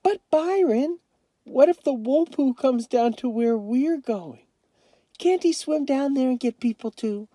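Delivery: put-on high, pleading little girl's voice; worried little girl. A narrator's reading. Joey frets to Byron about the Wolpoo, worried and high. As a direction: ask it like a scared small child.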